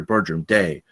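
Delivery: ordinary talk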